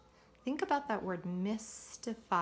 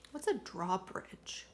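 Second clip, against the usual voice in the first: ordinary delivery, nothing marked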